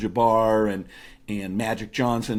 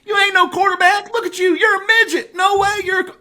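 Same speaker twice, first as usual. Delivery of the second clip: Larry Bird; 7 dB louder than usual. Retold or imitated. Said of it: "hick from French lick accent".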